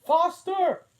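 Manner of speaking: very high, loud cry of the Queen; the Queen screaming to hurry; cried out. A narrator's reading. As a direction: shriek it at full pitch, urging her on. The Queen urges running.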